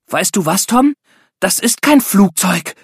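put-on a girl bursting with news, calling out; excited call. A narrator's reading. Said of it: "rief sie aufgeregt". (aufgeregter Ruf, höher und etwas langsamer)

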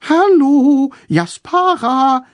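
sing-song high ghostly voice; fluting high voice. A narrator's reading. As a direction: coo it up high and sweet. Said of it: "flötete Tom mit hoher Stimme".

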